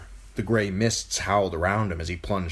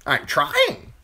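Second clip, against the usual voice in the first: higher, louder creature voice; testy crow; 7 semitones higher, 6 dB louder than usual. A narrator's reading. Crow replying to Bran's plea, testy crow voice. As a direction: answer back as an impatient bird.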